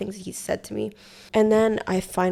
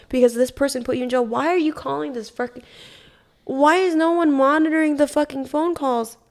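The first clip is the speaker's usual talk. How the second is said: higher, louder indignant outburst; outraged rant; 6 semitones higher, 4 dB louder than usual. Real, not acted. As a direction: vent the fury at the system. Outraged at unmonitored jail calls.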